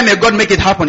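normal talking voice

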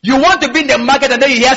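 ordinary delivery

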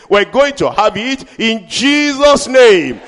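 loud, slow preacher's shout; shouted declaration. Acted. Preacher shouting the promise to his church, loud and slow. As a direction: cry it out to the rafters.

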